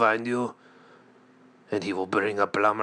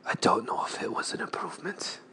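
fast whisper; 5 semitones higher, 4 dB quieter, faster than usual. Acted. Deadpan whispered aside.

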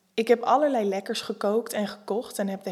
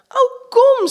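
very high and much louder, thrilled; enthusiastic; 13 dB louder than usual. Acted. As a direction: sweep him along with delight. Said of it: "zeg ik enthousiast".

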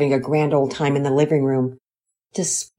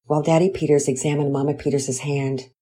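plain reading voice

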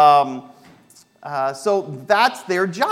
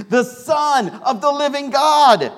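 rising to a higher, louder, slow proclamation; bold declaration; 10 semitones higher, 5 dB louder, slower than usual. Acted. Peter answering Jesus, building to a slow loud proclamation. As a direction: proclaim it with growing conviction. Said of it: "he speaks up".

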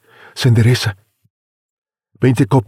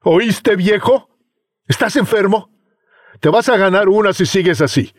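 put-on higher sneering young man; mocking threat; 10 semitones higher than usual. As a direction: taunt and threaten the old man. Youth threatens old man.

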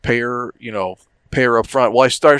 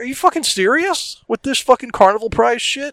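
much higher, ranting; incredulous rant; 11 semitones higher than usual. Retold or imitated. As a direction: rail at the cheap prize. Retold sarcasm at the vendor.

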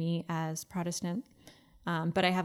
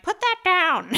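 high, loud scolding grandma; stern grandma; 11 semitones higher, 11 dB louder than usual. Retold or imitated. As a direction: snap it like a stern old grandma. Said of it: "a grandma ... being like".

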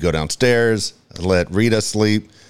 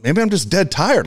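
teary excuse; 9 semitones higher than usual. Retold or imitated. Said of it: "And I'm like".

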